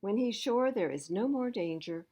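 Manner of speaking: ordinary delivery, nothing marked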